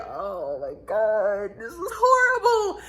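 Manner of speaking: drawn-out, hammed-up dismay; mock horror. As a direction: start slow, soar high in mock horror. Retold horror at being called.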